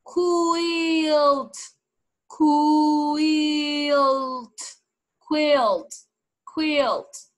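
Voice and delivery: over-articulate quilt slowly; exaggerated lip demo. Shown as a demonstration. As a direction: stretch each quilt for the children to watch. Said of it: "Miren mis labios".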